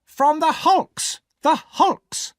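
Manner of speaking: high, loud, slow shout; impatient shout. A narrator's reading. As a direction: hammer the answer at him. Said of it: "my sister answered".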